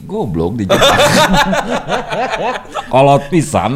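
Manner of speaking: words spilling out through real laughter; cracking up mid-sentence; laughing. Real, not acted. Laughing at the story.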